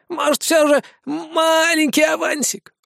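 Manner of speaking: high, slow begging whine; wheedling ghoul. A narrator's reading. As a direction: drag it out, palm held out. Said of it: "протянул раскрытую ладошку упырь".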